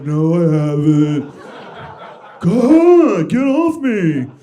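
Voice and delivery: loud, high, drawn-out mock whine; hammed-up exasperated outburst; petulant protest. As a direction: whine like a nagged teenager at the game console. Acted whining at wife over papers.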